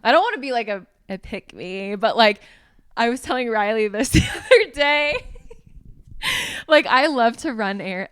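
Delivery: high, loud giggly voice; giggly pick-me girl. Acted. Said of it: "Oh my gosh, I sound like a pick me girl".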